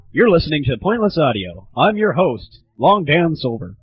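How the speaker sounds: normal talking voice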